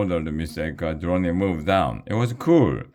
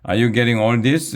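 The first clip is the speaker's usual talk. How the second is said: fast, higher, louder call to the crew; excited shout; 6 semitones higher, 5 dB louder, faster than usual. Acted. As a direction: call out eagerly to the cameraman. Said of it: "Miss Beard shouted at her cameraman".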